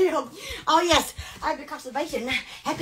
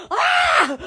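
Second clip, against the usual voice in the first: shriek; 11 semitones higher than usual. Real, not acted. Excited shrieking at electricity.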